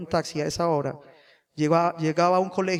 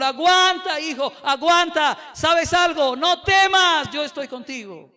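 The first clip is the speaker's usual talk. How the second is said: higher, louder, slow commanding call of God; God's voice; 8 semitones higher, 6 dB louder, slower than usual. Retold or imitated. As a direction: boom out God's answer to his son: hold on. God's reply voiced in the story.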